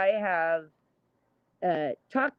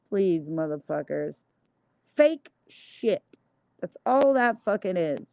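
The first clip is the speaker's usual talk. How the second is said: ordinary delivery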